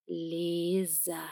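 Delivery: put-on "Лиза" slow and careful, childlike; careful like a child. A narrator's reading. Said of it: "старательно проговорил он, как маленький ребёнок".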